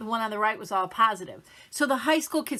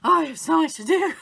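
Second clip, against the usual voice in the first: high, loud overwhelmed groan of a busy day; a hammed-up complaint about too much work; mock stressed whine; 7 semitones higher, 8 dB louder than usual. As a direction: moan it like the to-do list is crushing you. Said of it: "But I'll say, like".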